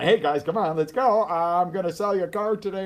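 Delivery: high, loud, pumped-up salesman cheer; excited. Shown as a demonstration. Coach showing viewers a motivated person, high and loud excitement. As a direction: hype the crowd like an eager car salesman. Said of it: "somebody who's motivated, really excited".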